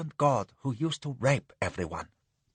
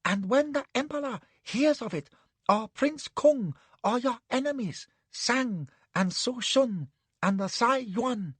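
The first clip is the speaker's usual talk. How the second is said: much higher, fretful man's voice; anxious warning; 10 semitones higher, 3 dB quieter than usual. Acted. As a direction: warn her in a fluster. Anne warns her.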